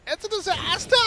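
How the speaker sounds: hammed-up, high-pitched catchphrase impression; Toby Wan. Caster quoting another caster's catchphrase, hammed-up high shout. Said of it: "As Toby Wan would say".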